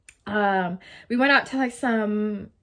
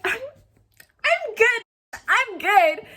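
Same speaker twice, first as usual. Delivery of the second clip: much higher, very slow, stretched polite no; awkward refusal; 13 semitones higher, 5 dB louder, much slower than usual. Retold or imitated. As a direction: squeak out a nervous no thanks. Awkwardly declining meeting brother.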